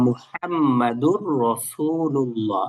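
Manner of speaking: slow, clear recitation; careful recitation. Shown as a demonstration. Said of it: "শুদ্ধভাবে". (ধীরে শুদ্ধ করে পড়া)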